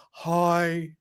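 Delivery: slow, low reporter impression; Alistair Gold. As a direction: introduce yourself low and drawn out. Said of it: "Ali Gold's fairly easy to do".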